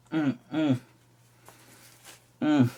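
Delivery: muffled grunts, bag held in teeth; tearing with teeth. Real, not acted. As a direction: strain while biting the bag open. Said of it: "use my teeth".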